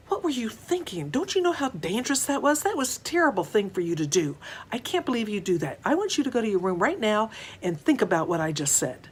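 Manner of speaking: high, fast angry scolding; scolding mom. Acted. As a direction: tear into the child like a furious mother. Mom scolds child after street.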